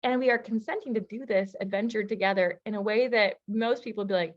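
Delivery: ordinary talk, nothing marked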